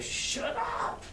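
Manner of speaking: whispered shut up; hushed warning. Acted. Bar patrons whispering to the doctor to hush. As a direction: hiss it to hush him. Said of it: "Everyone's like".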